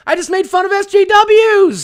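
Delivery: high, loud exasperated shout; loud protest to his chat; indignant shout. Real, not acted. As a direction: yell it in disbelief at chat. Defending his jokes to chat.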